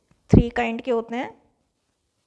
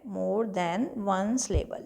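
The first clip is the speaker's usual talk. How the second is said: "more than one syllable" said very slowly and quietly; quiet, drawn-out "more than one syllable" for notes; slow, soft repeat; 2 semitones lower, 8 dB quieter, much slower than usual. Shown as a demonstration.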